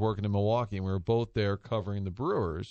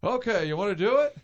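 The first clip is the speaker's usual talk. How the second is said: higher and louder, mildly put-on old announcer; gruff old announcer giving in; Harry Caray; 9 semitones higher, 5 dB louder than usual. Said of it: "And he said".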